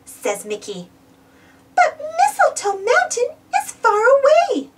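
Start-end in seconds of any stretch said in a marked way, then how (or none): 1.8-4.7 higher, worried Minnie voice; Minnie's voice. A narrator's reading. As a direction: voice Minnie a good deal higher. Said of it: "Minnie says".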